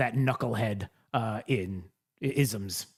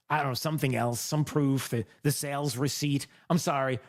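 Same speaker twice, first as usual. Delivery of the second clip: usual speaking voice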